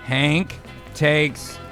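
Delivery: usual speaking voice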